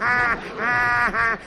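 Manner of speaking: high, forced ha-ha-ha; pleased, high joke laugh; laugh at own joke. Acted.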